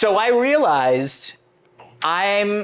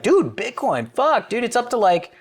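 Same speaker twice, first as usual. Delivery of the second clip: excited bragging; 6 semitones higher than usual. Retold or imitated. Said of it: "and I was like".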